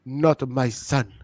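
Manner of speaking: Kofi's mom. Retold or imitated. Wrestler's mother rejects her son, mock solemn accent. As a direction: drag it out low in her put-on accent. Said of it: "I need to keep doing her accent".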